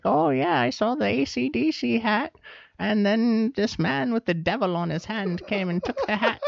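put-on high, wavering little old lady. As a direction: play a sweet, rambling old lady. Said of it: "I love doing my little old lady voice".

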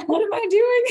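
high-pitched panicky squeak through laughter; laughing re-enactment of panic; mock stage fright. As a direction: squeal it as if flustered onstage, half laughing. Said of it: "having stage fright".

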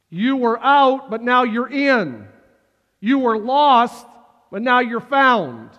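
loud, high rising sermon cadence; preaching at full force. Acted. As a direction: build and proclaim to the congregation. Preaching climax.

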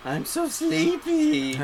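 high, slow sleepy whine. Pretend. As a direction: drag it out drowsily. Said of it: "mock sleepy drawl".

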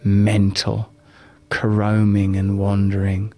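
calm, unhurried guiding voice. Acted. Leads a live guided meditation.

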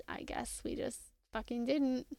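a bitter whispered aside under the breath. Real, not acted. Co-host griping about the cancelled Roadhouse reunion, whispered. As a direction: let it drop to a disappointed whisper.

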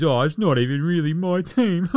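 whiny mocking athlete voice. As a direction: imitate a sulky star. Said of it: "Then he bounces after a couple of years".

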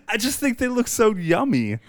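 laughing. Real, not acted. Laughing about eating penguins.

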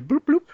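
silly bloop noise. Acted. Imitating little dots.

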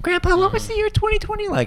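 put-on high little kid voice; grandchild voice. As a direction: ask like a curious grandkid. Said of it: "they're like".

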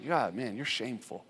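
low, soft dismissive aside; the world's put-down. Acted. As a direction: drop low and quiet, as the accusing world. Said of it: "is the world saying".

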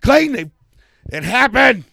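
high, loud call to a friend; hammed-up summons for help; mock call for backup. Calling Clayton for backup, loud and high.

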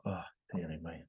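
mock dearie me. Retelling his reply to a rude woman, mock weary sigh. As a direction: tut it off quietly. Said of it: "It's like".

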